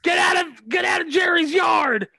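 high, drawn-out pretend shout; mock yelling mom. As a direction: holler like a mom across the street. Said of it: "your mom's yelling".

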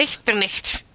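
"Nicht der nicht" with throaty ch in both nichts; guttural ch. Shown as a demonstration. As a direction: demonstrate Scots "nicht", ch as in loch. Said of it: "the guttural ch sound".

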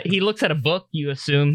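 normal talking voice

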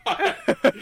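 can't keep a straight face playing the shocked mom; laughing mock outrage. Mom shocked her son told a stranger, laughing.

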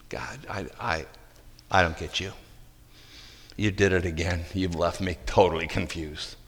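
soft, intimate confession; hushed prayer. Real, not acted. Preacher confessing confusion to God, hushed and soft.

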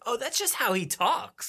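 imagine a listener quietly excusing it; mock realization. Host voicing a listener's thought, mock musing.

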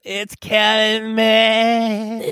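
put-on high, very slow drawn-out English accent; Daphne-style English accent. Friend mocks the English accent, high and very slow. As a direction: stretch it out in a high mock Mancunian lilt. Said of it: "makes me think of, uh, Daphne from, uh, Frasier".

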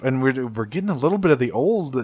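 ordinary talk, nothing marked

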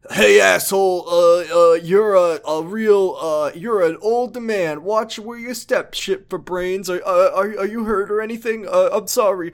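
high, loud, blustering punk voice, slowing on the insults; a cartoon tough guy barking then backpedalling; tough greaser bluster. Greaser bumps into Kiryu, high blustering tough-guy voice. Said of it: "and he's like".

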